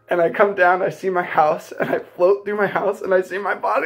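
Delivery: usual speaking voice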